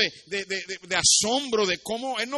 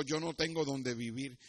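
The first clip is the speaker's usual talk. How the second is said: softer, understated answer; subdued orphan; 6 semitones lower, 10 dB quieter than usual. Acted. Homeless boy answers the king, subdued. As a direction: answer quietly as a humble child.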